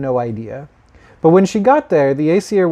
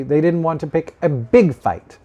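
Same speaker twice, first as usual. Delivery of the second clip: ordinary delivery, nothing marked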